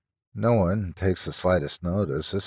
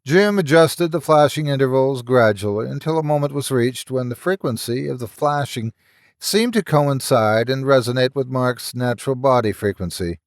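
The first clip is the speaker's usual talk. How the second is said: plain reading voice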